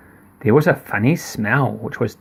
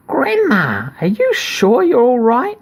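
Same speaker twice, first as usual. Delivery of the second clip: put-on little girl voice; 10 semitones higher than usual.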